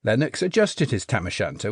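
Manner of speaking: ordinary delivery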